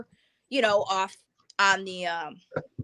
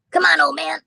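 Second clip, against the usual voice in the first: louder, higher call to a dog; 6 semitones higher, 8 dB louder than usual. Real, not acted. Calling to the dog mid-story.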